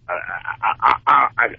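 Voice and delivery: ordinary talk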